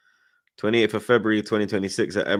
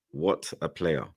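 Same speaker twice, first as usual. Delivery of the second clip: usual speaking voice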